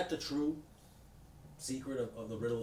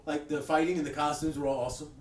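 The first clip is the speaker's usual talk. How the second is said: raised, louder mock friend voice; imitating Kevin; 6 semitones higher, 7 dB louder than usual. Quoting Kevin leaving the theater, mildly raised mock voice. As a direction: keep the friend's put-on voice going, a bit up. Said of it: "He goes".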